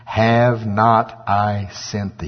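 slow, measured pronouncement; weighty, drawn-out commission; the Lord's command. Acted. The Lord sending Gideon to save Israel, slow and solemn. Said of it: "looked upon him and said".

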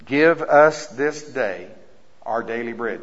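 very slow, drawn-out reading of the verse; slow emphasis. Shown as a demonstration. Preacher stresses the verse to his church, very slow. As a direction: lean on each word of the verse. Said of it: "really pay attention to verse eleven".

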